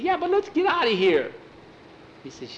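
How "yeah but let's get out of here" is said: higher, pleading and jittery; high anxious whine; nervous plea. Acted. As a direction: beg to leave, voice climbing. Scared boy wants to leave.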